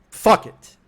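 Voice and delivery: sudden loud burst of frustration; angry outburst. Retold or imitated. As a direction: blurt it out loud, fed up. Exploding at wife, retold.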